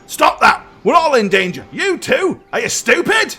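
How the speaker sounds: high, exasperated outburst; alarmed scolding. Acted. One rider berates the wagon lifter, high panicked scolding.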